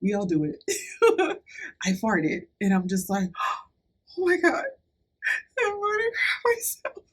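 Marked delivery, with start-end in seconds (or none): 4.2-7.0 high, tearful panicked wail; on the verge of tears. Retold or imitated. Panicking in the hospital bed, near tears and high. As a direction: near sobbing, voice pitched way up in panic. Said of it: "on the verge of tears".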